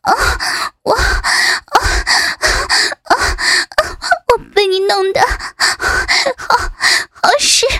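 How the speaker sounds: words broken by heavy breaths; moaning, gasping. Acted. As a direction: gasp out a halting reply. Breathless character in acted scene.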